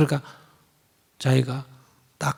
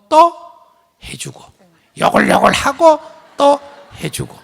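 mock ranting; 10 semitones higher, 9 dB louder than usual. Mocking parents who curse.

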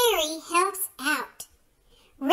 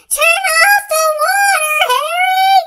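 even higher, louder shout with his name; 13 semitones higher, 11 dB louder than usual. Acted. Father still being squirted, shouting louder at Harry. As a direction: yell it at the top of the voice. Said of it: "shouts Harry's father".

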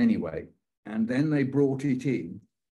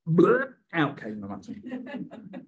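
retching bleh; 6 semitones higher, much slower than usual. Retold or imitated. Retelling being sick.

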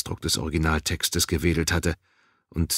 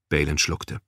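ordinary delivery

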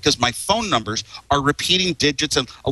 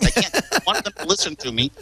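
usual speaking voice

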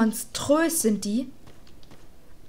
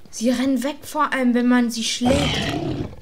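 ordinary talk, nothing marked

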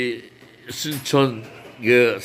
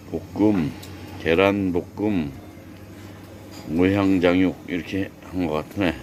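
calm, lower narrator voice; documentary narration; 7 semitones lower, 3 dB quieter than usual. Acted. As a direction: narrate the scenery like a travel show. Morning scenery narration.

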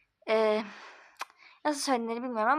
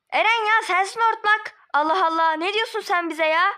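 high, loud indignant snapping back; angry; 8 semitones higher, 6 dB louder than usual. Acted. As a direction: scold him, loud and affronted. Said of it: "ben kızıyorum". (kızgın, yüksek sesle çıkışma)

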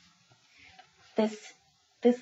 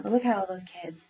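usual speaking voice

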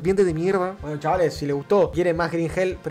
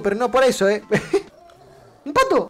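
ordinary delivery

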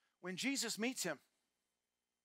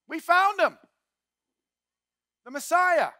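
higher and louder, thrilled news; excited announcement; 7 semitones higher, 9 dB louder than usual. Retold or imitated. Philip telling Nathanael they found the Messiah, excited. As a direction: burst out with the big discovery. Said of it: "And he says".

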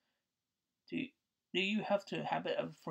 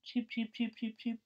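high chirpy cheeps; baby chick peeps; 6 semitones higher than usual. Acted. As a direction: peep like a tiny chick.

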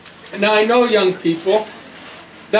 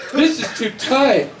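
mocking whiner. Preacher mocking a person who complains, whiny. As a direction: sneer at someone who wants to be free.

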